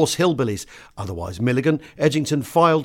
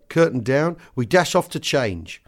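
ordinary delivery, nothing marked

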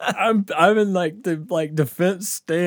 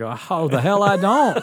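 slow, higher, cocky comeback; defiant retort; 6 semitones higher than usual. Retold or imitated. Teen answers the cop's warning, slow and cocky. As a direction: drag it out to mock the cop.

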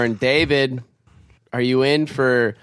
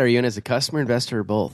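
usual speaking voice

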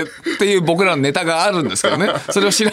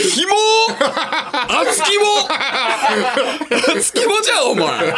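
laughing tease; 9 semitones higher than usual. Real, not acted. Teasing a friend's love story.

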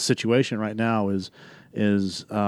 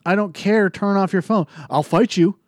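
higher, louder mock-angry challenge; retold threat; 9 semitones higher, 4 dB louder than usual. To kid recording the movie, retold heated threat. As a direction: square up and threaten him again. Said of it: "I said".

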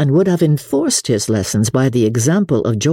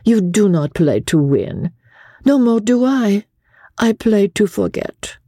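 put-on high-pitched foreign-accented lament; foreign lady, wistful; 9 semitones higher than usual. A narrator's reading. Masked lady reproaches old acquaintance Joss, plaintive foreign voice. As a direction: plead your sorrows as the foreign lady.

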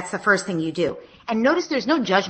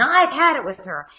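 louder, higher mock yell; 6 semitones higher, 6 dB louder than usual. Son fed up with his sister, mock yelling. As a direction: shout it like a fed-up kid. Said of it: "he was yelling".

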